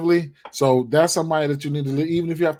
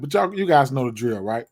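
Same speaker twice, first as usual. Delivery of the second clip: usual speaking voice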